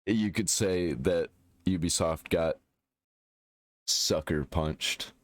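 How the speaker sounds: slow, with a long pause before the punchline; deliberate pun delivery. Real, not acted. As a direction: draw it out and hold before "sucker punched".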